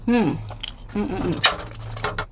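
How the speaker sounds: talking through a mouthful of pastry; mouth full. Real, not acted. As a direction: mumble around a bite. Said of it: "I'm sorry, you guys. I just, I needed to get my sweet fix".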